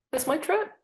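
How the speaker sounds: high, put-out whine of complaint; mock indignant protest. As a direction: act out a petulant high protest. Demanding her own trip.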